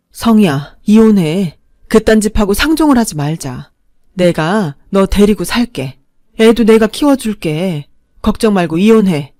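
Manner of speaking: loud, protective urging; aunt urging niece to divorce, loud and protective; indignant aunt. Acted. Aunt furious, supports her.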